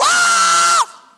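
long, very high, loud scream; mock scream. Abednego standing in the fire, screaming in mock terror. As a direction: let out a sustained high shriek. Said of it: "What are you screaming for?".

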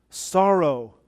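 normal talking voice

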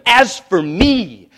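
slow, loud, looming divine decree; wrathful king speaking slowly; terrifying voice. Acted. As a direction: let each word land like a threat. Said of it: "but in terrifying voice".

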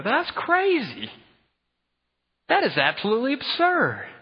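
high, stretched-out cry of disbelief; disbelieving disciples. Retold or imitated. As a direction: act out the disciples gasping that it's absurd. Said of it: "they go".

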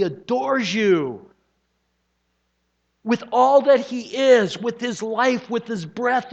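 high, loud, impassioned sermon climax; full-force preaching. Real, not acted. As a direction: proclaim it to the congregation in rising, ringing phrases.

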